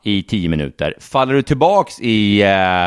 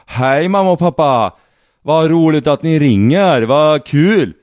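put-on Värmland accent; 3 semitones higher, 4 dB louder, slower than usual. Ex greeting her parents in a hammed-up Värmland accent. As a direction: ham up a cheerful Värmland accent. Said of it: "Värmlänningar låter så när de pratar".

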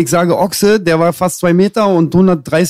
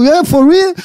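amazed; 12 semitones higher, 4 dB louder than usual. Retold or imitated. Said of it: "Er so".

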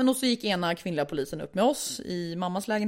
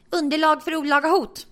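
loud, high declaration; indignant son; 7 semitones higher, 5 dB louder than usual. Retold or imitated. Neighbour's son filming the quarrel, loud and indignant.

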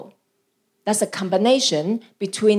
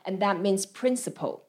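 ordinary talk

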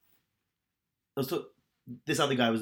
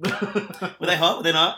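very slow, laughing, louder; 5 semitones higher, 7 dB louder, much slower than usual. Real, not acted. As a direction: drag it out through a laugh. Excited, laughing question.

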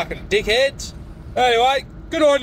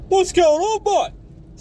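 mock tradie greeting; 8 semitones higher than usual. Caller mimics annoying site greeting.

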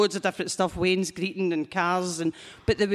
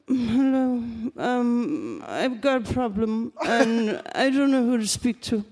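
[name] impersonation; 2 semitones higher, slower than usual. Retold or imitated. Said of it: "doing a [name] impersonation".

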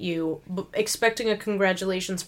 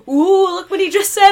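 higher, louder mock shocked ooh; mock gasp; 9 semitones higher, 8 dB louder than usual. A podcaster mocking shocked partygoers, high and loud. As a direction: play the scandalized crowd. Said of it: "everybody's looking around like".

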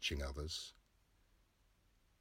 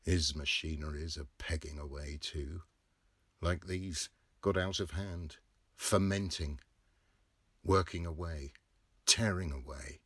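plain reading voice

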